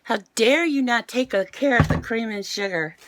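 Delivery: usual speaking voice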